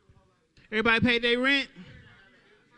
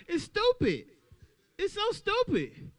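higher, slower indignant complaint; higher exasperated whine; exasperated rant; 9 semitones higher, slower than usual. Acted. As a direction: lean into the outrage. Ranting about receipt checks.

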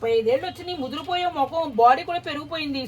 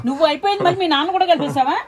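raised, indignant challenge; loud scolding; 3 semitones higher, 8 dB louder than usual. Real, not acted. Woman rebuking him for dragging his father in, loud scolding.

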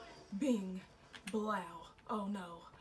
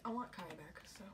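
whispered aside; 3 semitones lower, 11 dB quieter than usual. Real, not acted. Muttering during game.

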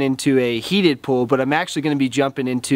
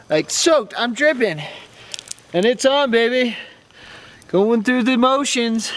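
shivering; 5 semitones higher than usual. Real, not acted. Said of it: "I can't talk 'cause I'm so cold".

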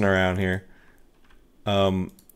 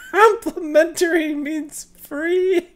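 much higher polite woman's voice; woman client; much slower than usual. Acted. Anna thanks the ghost hunter, much higher woman's voice. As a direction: play the relieved client, high and gracious.

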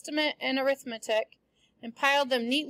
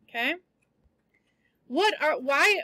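normal talking voice

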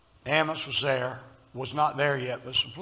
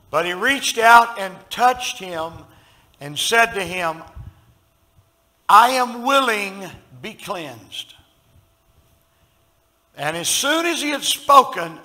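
preaching; 5 semitones higher, 11 dB louder, slower than usual. Acted. Preaching at full force.

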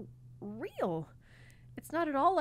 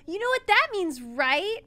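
excited; 11 semitones higher, 13 dB louder than usual. Acted. Mars still excited.

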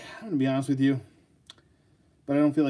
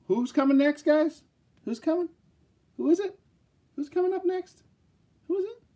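high, excited teasing questions, each climbing higher; squealing hype. Real, not acted. Vlogger teasing viewers about the next figure, squealing high excitement. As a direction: tease the viewers like a giddy game show host. Hyping the final figure reveal.

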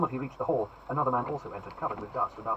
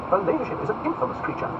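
haughty duke; 10 semitones higher, 3 dB louder than usual. Acted. Buckingham voiced high.

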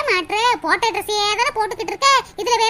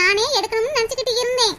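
ordinary talk

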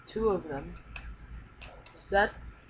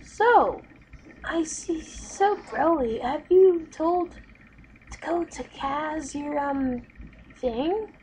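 much higher, teasing and drawn out; high prying friend voice; 8 semitones higher, 4 dB louder, slower than usual. Acted. Friend pressing Broly about telling Kaz, high and teasing. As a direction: coax the secret out of her.

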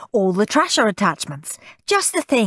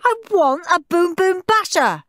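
high, stretched-out bratty yell; furious high-pitched demand; screamed tantrum; 12 semitones higher, 3 dB louder than usual. A narrator's reading. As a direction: throw a full child's tantrum. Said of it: "screamed Henry".

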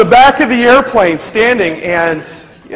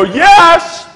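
loud answer; 9 semitones higher, 6 dB louder than usual. Retold or imitated. Answering her shout.

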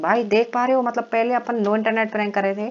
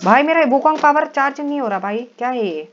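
high, loud complaint; exasperated outburst; 7 semitones higher, 5 dB louder than usual. Real, not acted. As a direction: cry out in frustration at the game. Game power fails to charge. (झुंझलाकर ऊँची आवाज़ में शिकायत)

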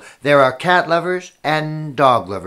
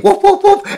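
high, loud woofs; dog barking; 7 dB louder than usual. Acted. Storyteller voices a dog chasing the cat, loud high barks. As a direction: bark like an excited dog.

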